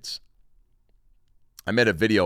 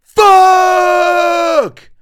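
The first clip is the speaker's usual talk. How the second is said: screamed, much higher and louder wail; anguished shout; 19 dB louder than usual. Retold or imitated. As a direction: howl at the empty wrapper. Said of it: "just going".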